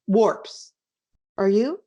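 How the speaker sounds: ordinary delivery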